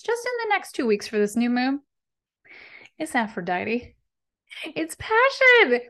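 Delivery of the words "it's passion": passionate. Real, not acted. Naming Aphrodite's energy.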